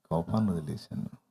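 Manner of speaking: soft, low and overly serene; hammed-up long-suffering calm; mock saintly patience. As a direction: play the man who has given up anger, all sweetness. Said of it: "దీర్ఘశాంతంగా".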